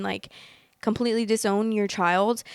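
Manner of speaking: normal talking voice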